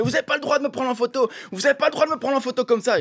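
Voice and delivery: high-pitched indignant mock yell; imitated yelling. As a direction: imitate a man yelling in protest. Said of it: "Et il dit, il hurle".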